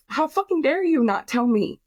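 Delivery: high, indignant retort; incredulous angry demand; angry outburst. Retold or imitated. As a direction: fling it back at them in outrage. Retold furious outburst at family.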